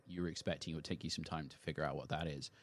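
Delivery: ordinary delivery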